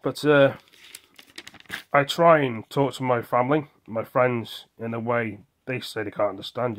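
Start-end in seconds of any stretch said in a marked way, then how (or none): none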